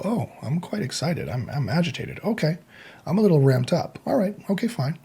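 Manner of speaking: soft, hushed self-talk, ending in a whisper; calm inner voice. Shown as a demonstration. Speaker models noticing their own excitement, softly to himself. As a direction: talk yourself down gently, under your breath.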